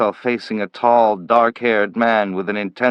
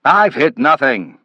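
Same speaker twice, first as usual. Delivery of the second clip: louder, higher irritated retort; irritable; 5 semitones higher, 5 dB louder than usual. A narrator's reading. Manville correcting Slade, irritable and loud. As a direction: bite back with annoyance. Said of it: "said irritably".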